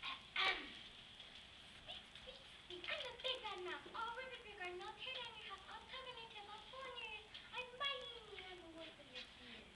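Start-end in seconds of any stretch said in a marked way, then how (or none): 1.8-9.6 much softer, fading squeaky threat; a tiny mouse whispering menace; Big Bad Mouse note. Mouse reads note at the second door, softer and softer. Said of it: "It read".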